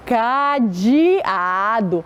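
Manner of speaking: "cadeado" stretched as ca, de, a, do; syllable-by-syllable reading. Shown as a demonstration. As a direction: sound out "cadeado" very slowly. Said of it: "Vamos ler com a tia".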